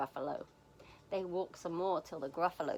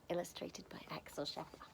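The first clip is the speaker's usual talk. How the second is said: ordinary delivery, nothing marked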